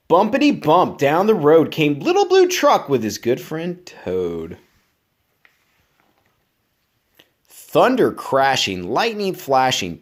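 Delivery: lively, bouncy storybook reading; reading to children. Acted. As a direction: read it up to keep a child listening. Reads picture book aloud.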